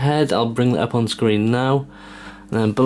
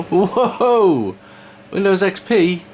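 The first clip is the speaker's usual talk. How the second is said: high, amazed exclamation; surprised; 9 semitones higher, much slower than usual. Real, not acted. Old PC boots into Windows XP.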